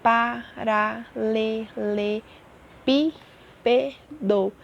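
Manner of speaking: pa-ra-le-le-pí-pe-do, very slow with gaps; syllable by syllable. Shown as a demonstration. Teacher counting syllables with pupils, "Paralelepípedo" very slowly split. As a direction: count out "Paralelepípedo" one separated syllable at a time. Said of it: "vamos contar a quantidade de sílabas que tem".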